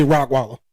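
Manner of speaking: ROCKWALLER for Rottweiler, with a k and no t; slang pronunciation. Shown as a demonstration. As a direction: say ROCKWALLER as rock plus waller. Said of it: "nobody really knows the real name for it".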